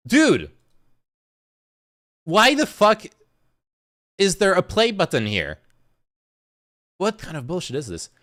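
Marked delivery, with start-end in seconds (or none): 0.1-5.6 loud, raised, baffled exclamation; louder and higher, thrown by it; incredulous outburst. Real, not acted. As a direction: blurt it out in real disbelief. Startled by what plays on screen.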